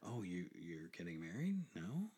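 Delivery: usual speaking voice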